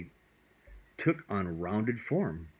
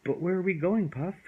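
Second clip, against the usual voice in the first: put-on high, louder anxious child; worried boy; 10 semitones higher, 4 dB louder than usual. A narrator's reading. Boy pulling back from the dragon, high and worried. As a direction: ask it with a worried pull back.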